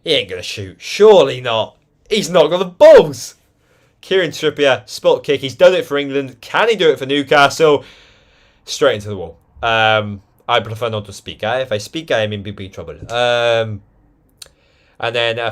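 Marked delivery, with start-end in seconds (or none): 0.0-9.4 lively football play-by-play; match commentator voice; live commentary. Acted. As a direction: call the match as it unfolds.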